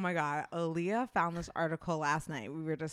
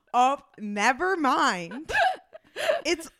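laughing; 4 semitones higher, 8 dB louder, slower than usual. Retold or imitated. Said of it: "she goes".

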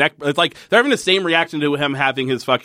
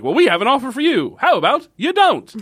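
high pitched commercial pitch; hard sell ad; 7 semitones higher than usual. Retold or imitated. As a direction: sell it like a cheesy ad. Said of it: "doing it to the style of a commercial".

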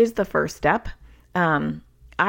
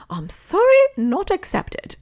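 much higher, squeaky cartoonish voice, drawn out at the end; jokey silly voice; 14 semitones higher, 4 dB louder, slower than usual. Mom jokingly refusing her son's compulsive apology, in a silly high voice. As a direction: tease him in a goofy high-pitched voice, stretching "not accepted". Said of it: "I'd say it kind of in a jokey way".

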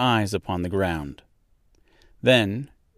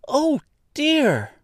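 put-on very high, louder girlish cry; dismayed cry; 5 dB louder than usual. A narrator's reading. Girl seeing the feet under the house, dismayed cry. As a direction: cry out in alarm, pitched right up. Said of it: "cried Dorothy".

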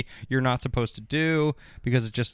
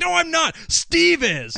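much higher, louder squeaky denial; mock denial; 4 dB louder, slower than usual. Brandon denying to Andrea, high mock squeal. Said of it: "he's like".

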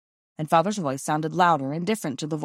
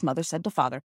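ordinary delivery, nothing marked

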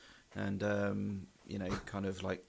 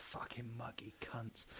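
whispered insult; 11 dB quieter, slower than usual. Pretend. Mocking the muggy weather to his co-host in a whisper. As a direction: hiss it under your breath, joking.

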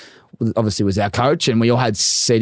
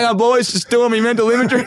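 high, cheeky mock voice; imitating Kepler; 11 semitones higher than usual. Kepler reenacting for the boys in the showers, high and cheeky. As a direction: sing it out high like a cheeky larrikin. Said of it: "He'd go".

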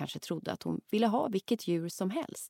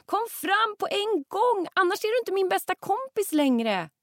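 calling louder; 5 dB louder than usual. Acted. Hetty shouts at hiding Rut, loud demanding call. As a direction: yell it out so she must answer. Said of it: "ropar hon ännu högre".

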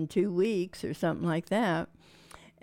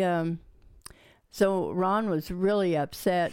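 ordinary talk

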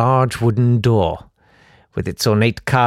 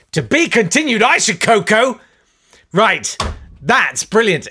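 much higher, excited outburst; outraged exclamation; 11 semitones higher than usual. Real, not acted. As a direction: blurt back the cliffhanger in disbelief. Host exclaims at the cliffhanger.

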